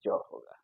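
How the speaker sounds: ज breathed as an English z; whispered z sound. Shown as a demonstration. Teacher whispering the z sound to students. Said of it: "इस एस का sound क्या होगा?".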